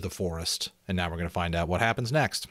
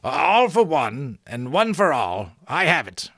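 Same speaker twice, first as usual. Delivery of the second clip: giant's shout; 6 semitones higher, 5 dB louder than usual. Acted. Giant remembering the password at last, shouting. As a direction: shout it as the line comes back to you. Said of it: "the giant shouted".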